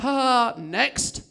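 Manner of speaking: brief mock laugh, then a plain "next"; dismissive laugh. Comedian imitating a crowd's polite laugh, dismissive. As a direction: laugh it off and move on. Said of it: "one of those laughs where you're like".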